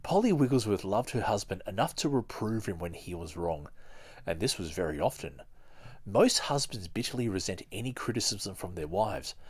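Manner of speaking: ordinary talk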